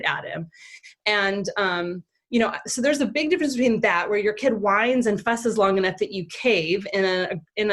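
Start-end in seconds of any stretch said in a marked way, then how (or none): none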